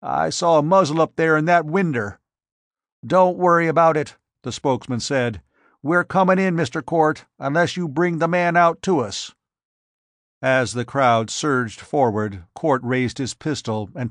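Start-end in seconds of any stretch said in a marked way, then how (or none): 0.0-9.3 louder, higher mob voice; defiant countryman. Acted. Mob men defying Court's armed threat, louder. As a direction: push back defiantly.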